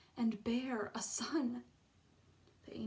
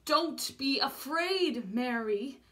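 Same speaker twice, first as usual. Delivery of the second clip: loud, slow, higher-pitched call; heavenly voice calling; 3 semitones higher, 7 dB louder, slower than usual. A narrator's reading. Unseen voice calls again to frightened Mary, loud and slow. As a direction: call it out slowly and strongly from the light. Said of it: "The voice came again".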